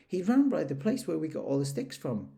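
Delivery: lightly voiced small animal; small piglet. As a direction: pipe up like a tiny pig. Piglet speaks.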